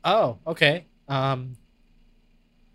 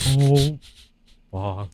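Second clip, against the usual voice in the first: Winnie the Pooh; 3 semitones lower, 6 dB louder, slower than usual. Pretend. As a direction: sigh it like a sleepy bear. Repeating Pooh line.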